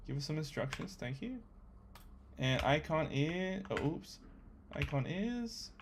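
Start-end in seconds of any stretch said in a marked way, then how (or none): none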